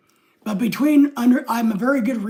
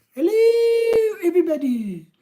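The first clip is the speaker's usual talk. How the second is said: much higher, sing-song hello; high squeaky greeting; 9 semitones higher than usual. Retold or imitated. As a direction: pitch the hello way up like a cartoon. Reading a viewer greeting twice.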